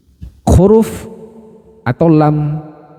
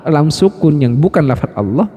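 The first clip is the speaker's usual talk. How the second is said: usual speaking voice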